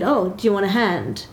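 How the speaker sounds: normal talking voice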